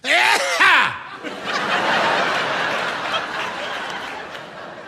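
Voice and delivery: high breathy scoff laugh; the disapproving sound he inherited; airy high huff; dad's disapproving scoff. Shown as a demonstration. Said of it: "inherited this sound from him".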